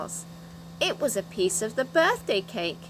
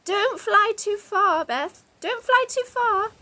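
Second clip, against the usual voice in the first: high, loud, slow call; a worried call upward; 11 semitones higher, 4 dB louder than usual. Acted. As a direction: call up to her in the air. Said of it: "Franny called out to her".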